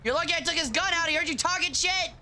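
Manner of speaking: high, fast excited teasing. Real, not acted. Excited teasing during fight.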